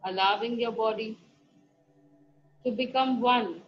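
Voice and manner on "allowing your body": usual speaking voice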